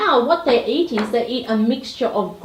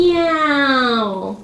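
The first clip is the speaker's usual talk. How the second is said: long, drawn-out meow; one stretched, wailing cat cry; 2 semitones higher than usual. Shown as a demonstration. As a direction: imitate a cat for a toddler. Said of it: "The cat makes the meow sound".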